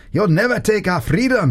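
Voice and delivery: mock Braveheart battle cry; hammed-up freedom speech; light Scottish war cry; rallying cry. Shown as a demonstration. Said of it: "go back from the microphone a little bit and say".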